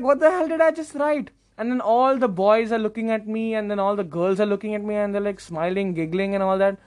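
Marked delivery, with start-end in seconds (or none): 0.0-1.3 high-pitched appalled disbelief; cringing at himself. Retold or imitated. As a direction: question your own sanity. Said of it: "I cringe at myself".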